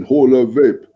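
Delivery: mock German accent like Arnold Schwarzenegger, badly done; clumsy Austrian-German accent impression. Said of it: "really bad fucking Arnold Schwarzenegger stroke German accent".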